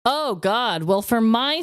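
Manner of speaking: normal talking voice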